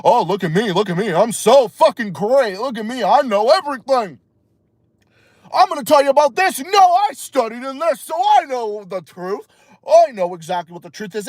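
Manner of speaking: sing-song mocking of a boastful expert; mock know-it-all expert. Streamer mocking so-called experts who brag, mock know-it-all voice. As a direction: put on a self-important show-off. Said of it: "experts that just act like they know everything".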